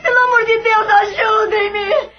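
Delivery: sobbing pleas in pain, cries rising; tearful, panicked crying out in pain; anguished crying. Acted. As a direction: beg for help through tears and pain. Idalina in pain, begging for help.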